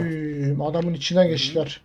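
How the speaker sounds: quiet, low-key remark; muted disbelief. Real, not acted. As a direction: say it softly, almost to yourself. First muted reaction to the play. (kendi kendine, alçak sesle)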